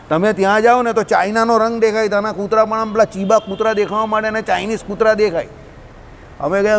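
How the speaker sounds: ordinary talk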